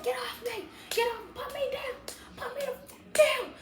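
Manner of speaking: very high squeaky mock protest; yapping chihuahua tantrum. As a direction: yelp it like a tiny dog being carried. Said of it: "being a freaking chihuahua".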